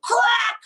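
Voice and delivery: high, loud crack of the bat. Retold or imitated. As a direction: make the crack of a bat hitting the ball. Bat hitting ball.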